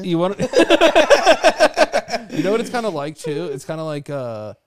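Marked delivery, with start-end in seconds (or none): 0.4-2.2 high burst of laughter; loud laugh. Real, not acted. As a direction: crack up loudly. Big laugh at the joke.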